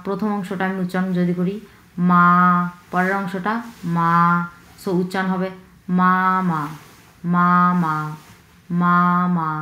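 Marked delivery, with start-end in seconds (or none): 2.1-2.2 মা held long and level; flat first tone. Shown as a demonstration. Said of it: "খুবই ফ্ল্যাটভাবে উচ্চারণ করব". 4.0-4.1 মা held long and level; flat first tone. Shown as a demonstration. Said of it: "খুবই ফ্ল্যাটভাবে উচ্চারণ করব". 5.9-9.5 মা held long and level; flat first tone. Shown as a demonstration. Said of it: "খুবই ফ্ল্যাটভাবে উচ্চারণ করব".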